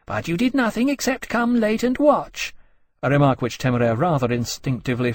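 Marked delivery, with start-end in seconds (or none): 0.1-2.5 high-pitched haughty jab; condescending. A narrator's reading. She-dragon taunts the latecomer, condescending. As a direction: belittle him in a high voice. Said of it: "with an air of condescension".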